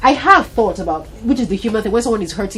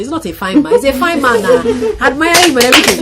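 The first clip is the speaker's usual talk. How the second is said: higher, louder teasing through laughter; laughing tease; 6 semitones higher, 7 dB louder than usual. Retold or imitated. Wife teasing her husband about the footballer, laughing. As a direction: tease him, laughing at his jealousy. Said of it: "And so I said".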